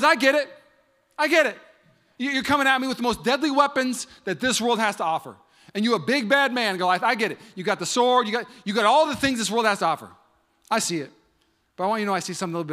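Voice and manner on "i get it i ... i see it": bold David. Acted. Voicing David before Goliath.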